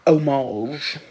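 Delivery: homage said oh-MAHZH, no h; defiant homage as oh-MAHZH; own pronunciation. Shown as a demonstration. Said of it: "I'm still gonna say".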